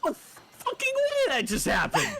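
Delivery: laughing. Real, not acted. Shocked at game result.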